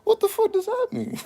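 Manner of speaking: stunned coworker discovers everyone smokes; high laughing disbelief. Retold or imitated. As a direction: squeal it out half laughing, stunned. Shocked at puff up phrase.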